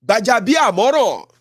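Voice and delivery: slow, loud, drawn-out name; loud name call. Real, not acted.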